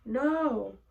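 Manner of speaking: high, drawn-out refusal; refusing aunt. Retold or imitated. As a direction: imitate an aunt's long-voiced objection. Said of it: "my aunt was like".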